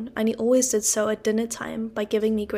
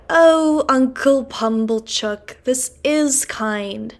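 gushing thanks; 3 semitones higher, 6 dB louder, much slower than usual. Acted. Sister gushing to Uncle Pumblechook over his gifts, slow and effusive. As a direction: lay the gratitude on thick, very slowly.

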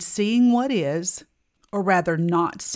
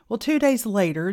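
ordinary talk

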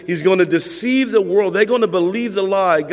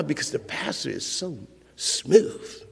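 dreamy hush; 4 semitones lower, 7 dB quieter than usual. Retold or imitated. Mocking a swooning churchgoer.

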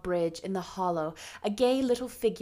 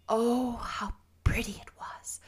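awed whisper. Acted. Awed at the dress.

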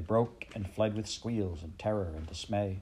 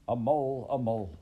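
mildly raised battle cry; war cry; 3 semitones higher, 3 dB louder than usual. A narrator's reading. Mole storming the banquet, a mild war cry. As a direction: call it out as a restrained war cry. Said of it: "shouting his awful war cry".

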